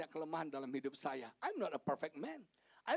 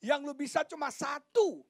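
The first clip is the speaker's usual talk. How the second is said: imitating a wife's scolding, building higher; mock nagging wife; 11 semitones higher, 6 dB louder than usual. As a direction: nag lightly in rising repeated jabs. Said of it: "Istri saya suka ngomel". (menirukan istri ngomel, nada makin tinggi)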